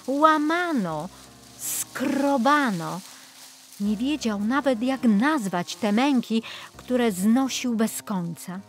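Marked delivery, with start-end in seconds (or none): none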